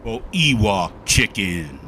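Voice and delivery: deep, slow, loud bubbly voice; deep bubble voice. Pretend. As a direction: put on a fizzy, frothy bubble voice. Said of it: "I gotta do the deep bubble voice".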